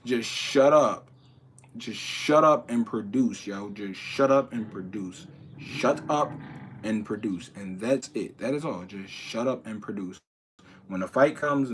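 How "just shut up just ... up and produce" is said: slow emphatic advice. Real, not acted. Hammering home one piece of advice.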